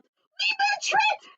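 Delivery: very high, loud panicked shrieking; panicked wail. Acted. Bird warning friends about the fox's lair, panicked shriek. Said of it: "Looney Little wailed".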